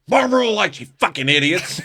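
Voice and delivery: high, fed-up snap, half laughing; exasperated retort. Retold or imitated. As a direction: bark it like you're sick of them. Annoyed Chad answers from corner.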